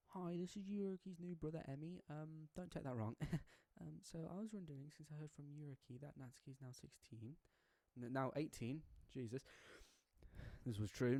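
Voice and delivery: high, soft, hesitant caller voice; young brother on phone. Acted. Yuriki's new brother calling Yuri, soft and nervous.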